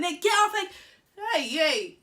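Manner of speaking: loud, high shout from passing drivers. Retold or imitated. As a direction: yell it across the road.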